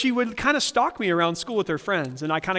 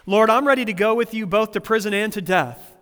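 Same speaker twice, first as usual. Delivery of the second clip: bold, louder and higher boast; Peter's bravado; 4 semitones higher, 6 dB louder than usual. Retold or imitated. Preacher voicing Peter's brash promise to Jesus, a bold loud boast. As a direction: puff up like brave Peter. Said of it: "Peter in his typical bravado".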